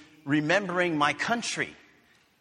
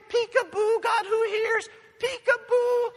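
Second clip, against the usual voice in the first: put-on very high, loud sing-song baby talk; hammed-up peekaboo to an infant; 8 dB louder than usual. Mother plays peekaboo with her baby, very high sing-song.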